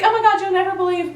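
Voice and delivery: high, fast giddy gushing; happy gushing. Retold or imitated. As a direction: gush like an excited friend. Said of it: "She told me in a happy way".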